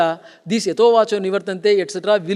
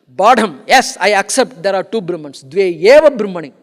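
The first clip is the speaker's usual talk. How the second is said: Badham and eva punched loud; emphatic; 8 dB louder than usual. Shown as a demonstration. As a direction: stress eva in Dve eva Brahmani, loud and firm. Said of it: "And eva, that emphasis".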